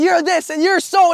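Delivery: little brother mock-yelling at his older brother; retold yelling. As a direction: replay the outburst as a hammed-up yell. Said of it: "I'm yelling at this guy".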